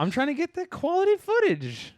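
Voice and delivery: very high, joking showoff voice; hammed-up pride in quality footage; mock showoff. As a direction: brag about the footage in a squeaky joke voice. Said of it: "I'm all about that quality, baby".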